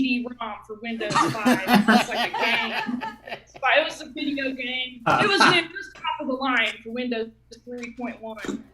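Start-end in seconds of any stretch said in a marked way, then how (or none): none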